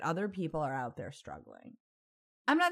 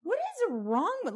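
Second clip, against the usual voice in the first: much higher, shrill-free mock outrage; horrified outburst. Retelling her reaction to the catcaller, high mock horror. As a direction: squeal it in disbelief. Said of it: "I'm like".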